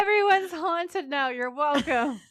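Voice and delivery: loud, high, jokey proclamation; mock announcement. Host having read the spell aloud, mock triumphant announcement. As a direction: call it out grandly, pitched well up.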